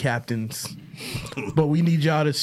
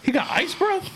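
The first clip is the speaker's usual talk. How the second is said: high, incredulous squeak; can't believe what he just heard; disbelief. Real, not acted. Disbelief at the claim.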